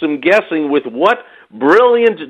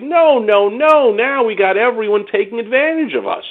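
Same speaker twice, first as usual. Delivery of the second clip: raised-pitch insistent no no no; higher, insistent retort; emphatic protest; 6 semitones higher than usual. Retold or imitated. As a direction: push back hard on the idea. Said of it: "I'm like".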